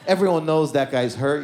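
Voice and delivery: usual speaking voice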